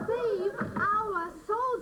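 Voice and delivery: each word drawn out like a signal; slow Morse-code reading. Acted. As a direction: spell the knocks out slowly. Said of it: "It's Morse code!".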